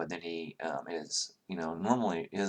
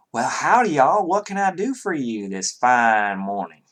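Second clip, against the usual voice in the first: reluctant Southern accent, hammed up for the table; 3 semitones higher, 7 dB louder than usual. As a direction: play it up as a friendly Southern stable hand. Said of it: "abhor the southern accent, and I won't do it".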